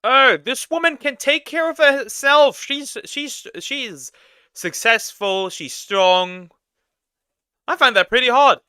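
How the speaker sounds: high, loud mocking guy voice; mocking dudebro voice. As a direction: parody an impressed man, way up high. Mocking a man's admiring words.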